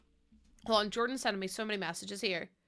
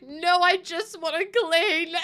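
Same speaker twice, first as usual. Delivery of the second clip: slow, wobbly half-sob through a laugh; tearful but laughing at herself; slow mock-bawling plea; laugh-crying; 7 semitones higher, 7 dB louder, slower than usual. Retelling her crying to husband.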